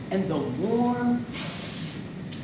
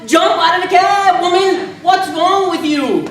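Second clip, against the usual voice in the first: loud, higher desperate shout at the wife; frantic yell; 5 semitones higher, 9 dB louder than usual. Retold or imitated. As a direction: yell at her from behind the cab.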